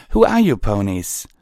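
high, loud question; demanding Twilight. A narrator's reading. Twilight questions the pony leader, high and loud.

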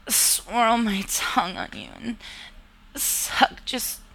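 usual speaking voice

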